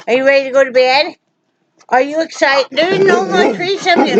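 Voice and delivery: fast, high doggy talk; pet baby talk. Acted. Talking to the dog.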